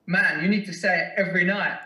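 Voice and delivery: hammed-up mimicry of an upbeat training partner, breaking into laughter; bad Lopez impersonation. Retelling his training mate's dinner-table advice in a bad mock voice. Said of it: "that was a really bad impersonation".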